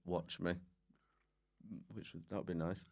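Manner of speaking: ordinary talk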